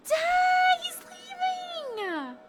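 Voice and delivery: very high, loud shriek of dismay; stunned fan shrieking at the twist; shocked outcry. Real, not acted. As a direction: cry it out, then stretch it slow. Shock at his leaving.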